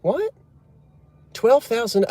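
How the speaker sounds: high yelp of mock shock; imitating a stunned shopkeeper; shocked disbelief. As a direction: squeal at the price. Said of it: "He said".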